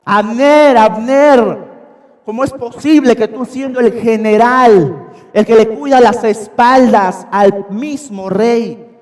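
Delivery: loud high yelling across the mountain; shouting. Retold or imitated. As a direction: yell his name from far away. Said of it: "dio voces gritando".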